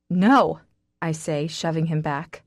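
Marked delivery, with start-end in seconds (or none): 0.1-0.6 a sharp, loud no as she shoves him away; loud refusal. Acted. Woman shoving her friend away, snapping a loud no. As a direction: throw the no at him, louder.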